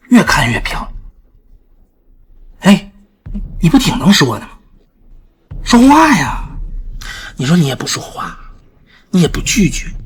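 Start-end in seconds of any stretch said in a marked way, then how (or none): 3.6-6.5 loud goading, rising to a high shout; taunting demand. Acted. Man demanding the silent woman speak, loud taunting. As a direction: goad until it becomes a shout.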